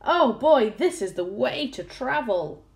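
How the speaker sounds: high, elated exclamations; delighted cries. A narrator's reading. Crew waving off the sharks, delighted cries. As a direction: cry out gleefully, peaking on the goodbye. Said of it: "they cried".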